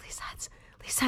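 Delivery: fast hushed whisper; urgent whisper. Retold or imitated. Pleading with the secretary on the phone, whispered fast. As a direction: whisper urgently so nobody else hears.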